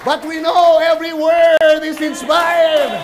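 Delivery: slow, loud proclamation; preacher thunders to the congregation; preaching shout. Real, not acted. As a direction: declare it to the whole church.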